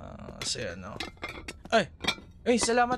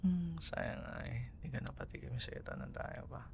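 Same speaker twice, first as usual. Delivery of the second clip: wistful murmur; 12 dB quieter than usual. Acted. Wistful remark about the past.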